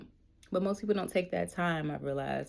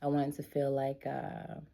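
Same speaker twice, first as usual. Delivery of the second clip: usual speaking voice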